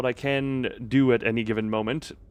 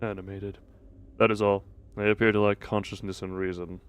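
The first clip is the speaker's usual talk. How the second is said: a hint of a lower, subdued cold figure; lightly voiced grim character; 7 semitones lower, 10 dB quieter, much faster than usual. Voicing a game character's dialogue, quietly grim. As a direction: read it like a detached observer.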